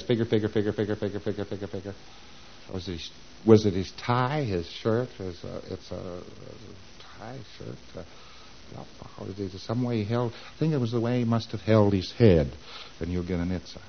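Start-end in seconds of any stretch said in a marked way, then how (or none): 0.0-1.9 soft mutter fading to almost nothing; figuring preclear. Acted. Client works out an answer, in a fading mutter. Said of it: "P C says". 2.7-12.5 very quiet, halting thinking aloud; pondering preclear. Acted. Client searches memory for the reminder, hesitant and hushed. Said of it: "P C says".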